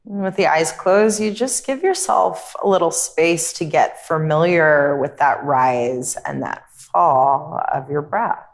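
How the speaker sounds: calm, unhurried meditation voice with long pauses; guided meditation. Real, not acted. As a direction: guide them gently inward, leaving space between phrases.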